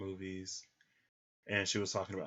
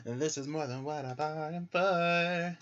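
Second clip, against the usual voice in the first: higher, louder mock-dramatic repeat; this is more than what I bargained for, hammed up higher; overwhelmed; 7 semitones higher, 7 dB louder than usual. Real, not acted.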